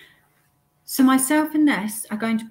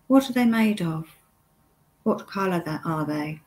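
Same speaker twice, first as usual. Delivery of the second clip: guided meditation. Acted. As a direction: lead the listener step by step, unhurried, pausing often.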